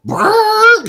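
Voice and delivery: high buzzing lip-trill engine noise; imitated car revving away. Friends retelling the movie, imitating the car driving off with a high lip-trill. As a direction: rev off like a car speeding away.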